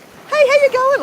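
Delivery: high-pitched call with a hint of Irish accent; attempted Irish accent. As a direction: imitate a friendly Irish greeting, pitched high. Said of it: "in their Irish accent".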